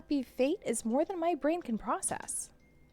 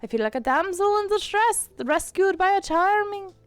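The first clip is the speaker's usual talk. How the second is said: higher, louder teasing Irish voice; mock-touched, lay the Irish on thick; Irish accent; 7 semitones higher, 5 dB louder than usual. Cat mocking Kara's concern, Irish accent. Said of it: "I'm losing my Irish".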